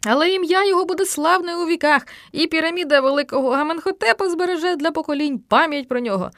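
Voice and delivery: high, solemn declamation; grand eulogy. A narrator's reading. Boy eulogizing the pharaoh at a pretend burial, grandiose. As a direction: proclaim his eternal glory grandly. Boy continues the funeral oration.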